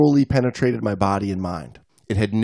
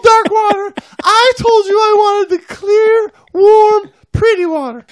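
high falsetto, teary whine; whimpering wife; 6 dB louder than usual. Acted. Wife wailing at her husband about the water, teary falsetto.

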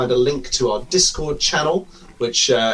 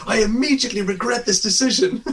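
laughing quote; 10 semitones higher than usual. Retold or imitated. Quoting Anchorman line while laughing.

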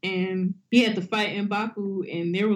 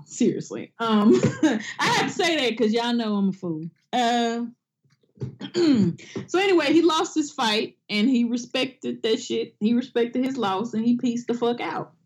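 ordinary talk, nothing marked

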